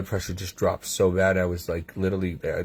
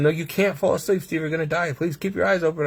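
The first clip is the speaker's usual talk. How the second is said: higher-pitched imitated pleading; urgent medical team; 7 semitones higher than usual. Team begging him not to fall asleep, imitated urgent pleading. Acting out the team's pleas.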